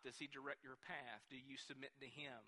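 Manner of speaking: quiet searching questions. Real, not acted. Preacher asking congregation if they submit, quiet searching questions. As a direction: drop low and soft to probe the listener.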